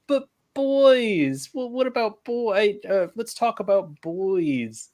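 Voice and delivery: put-on very high, slow, girlish pleading; high girly voice. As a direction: squeak it like a giddy schoolgirl. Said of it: "And Ochako's like".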